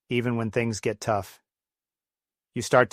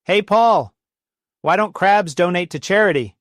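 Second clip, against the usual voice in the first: higher, louder shouted joke setup; yelling over a storm; 8 semitones higher, 8 dB louder than usual. Retold or imitated. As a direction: call it out over crashing waves. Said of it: "one of the guys yells".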